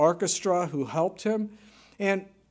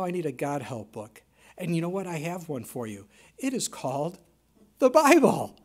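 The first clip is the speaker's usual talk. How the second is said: ordinary delivery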